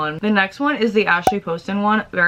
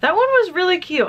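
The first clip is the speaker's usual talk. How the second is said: high, excited gush; 12 semitones higher than usual. Real, not acted. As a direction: gush about a cute book. Gushing about book.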